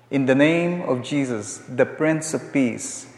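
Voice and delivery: usual speaking voice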